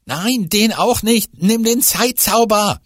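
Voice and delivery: hoch und laut drängen; gehetzt drängend. Acted. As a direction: ungeduldig zur Eile treiben. Fiete drängt. (gehetzt drängend)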